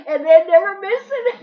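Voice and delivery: laughing through the words; high laughing squeal. Real, not acted. Laughing while telling mischief.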